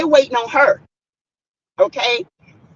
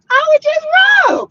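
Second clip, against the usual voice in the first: high-pitched, laughing admission; words squeezed through a laugh; 4 dB louder than usual. Real, not acted. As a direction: burst out laughing as you say it. Laughing about her rough past.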